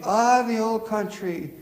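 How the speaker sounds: high, slow mock Scottish brogue; fond, high Scottish lilt, slowly; imitating Scottish caller. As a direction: savour it like a homesick Scottish lady. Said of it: "she would say".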